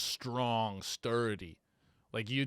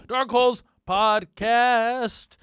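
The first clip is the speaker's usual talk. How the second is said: announcer plug; 9 semitones higher, 12 dB louder, much slower than usual. Real, not acted. Podcast plug.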